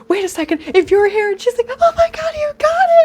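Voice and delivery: very high squealing friend; gushing fangirl squeal; excited squeal. Retold or imitated.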